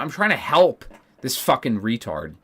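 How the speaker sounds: usual speaking voice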